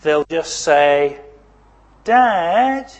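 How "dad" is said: high, loud call through the house; calling out for dad. Shown as a demonstration. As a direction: call out to check if anyone's home. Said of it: "They'll say it like that".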